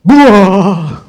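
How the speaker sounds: loud, high mock boo to frighten; scare shout. Brother jumping from under the bed to scare the kids, loud shout. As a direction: jump out and bark it. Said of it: "out jumps my brother and goes".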